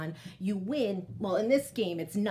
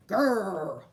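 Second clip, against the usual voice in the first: a short, louder bear growl; 3 semitones lower, 4 dB louder than usual. Acted. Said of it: "Old Bear growled".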